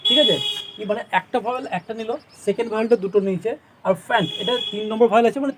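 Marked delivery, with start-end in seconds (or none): none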